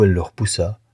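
plain reading voice

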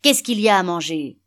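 loud, angry yell; shouted demand. A narrator's reading. Hungry father pounding the table, shouting for food. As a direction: shout it like a fist hitting the table. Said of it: "il frappa du poing sur la table et cria".

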